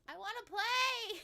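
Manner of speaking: excited whine. Real, not acted. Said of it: "I wanna play their games".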